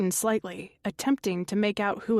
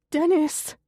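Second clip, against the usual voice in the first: high, louder plea of his name; pleading; 7 semitones higher, 4 dB louder than usual. Acted. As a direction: beg him, voice rising. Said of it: "Connie pleads".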